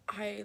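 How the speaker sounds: sudden sharp hiccup. Real, not acted. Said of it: "Sorry, I keep hiccuping".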